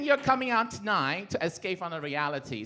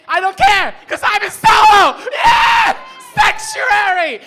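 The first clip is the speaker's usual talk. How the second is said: much higher, much louder gleeful yelling; triumphant shout; 14 semitones higher, 18 dB louder, slower than usual. Acted. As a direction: belt it out like a victory cry, stretching "Sanctuary" slowly. Triumphant cry about being in Soho.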